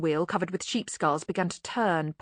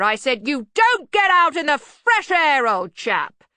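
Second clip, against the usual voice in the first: slow, high, hearty shout; louder repeat; 9 semitones higher, 8 dB louder, slower than usual. Acted. Arch-Chancellor addressing the dazed bursar, slow shout. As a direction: spell it out at a bellow.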